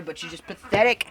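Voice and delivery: loud mock disgust; mock contempt. Host berating gullible viewers, loud mock contempt. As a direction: punch out the insult loudly.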